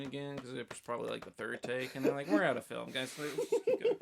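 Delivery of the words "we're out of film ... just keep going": laughing mock crew. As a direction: giggle out the crew's excuse.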